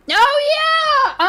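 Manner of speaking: sudden high, loud burst of hype; excited shout. Real, not acted. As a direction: yell it out, thrilled by the clip. Streamer hyped over clip.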